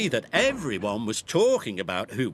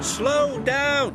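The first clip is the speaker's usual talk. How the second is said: high, loud slow down; calling out loudly; 13 semitones higher, 4 dB louder than usual. A narrator's reading. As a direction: shout it as a warning. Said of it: "Kelly called".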